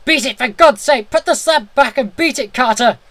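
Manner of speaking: high, loud desperate cry; piteous cry. A narrator's reading. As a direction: cry out for him to run. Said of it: "a piteous cry from Warren".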